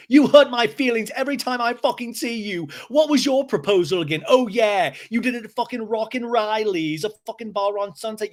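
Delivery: high, loud mock tirade; James yelling. Recapper imitating James yelling at Schwartz, high and furious. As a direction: imitate a hurt man lashing out at the top of his range. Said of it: "James yells again".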